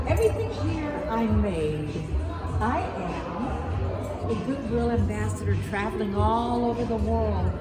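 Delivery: ordinary delivery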